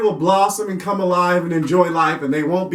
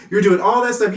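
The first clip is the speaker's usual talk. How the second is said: normal talking voice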